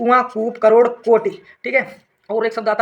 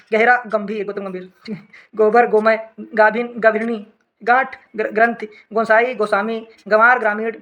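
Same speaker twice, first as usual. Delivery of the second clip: ordinary talk, nothing marked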